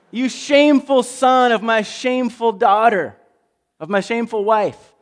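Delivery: loud, high raging rebuke; a king raging at his son; furious king. Acted. Saul berating his son.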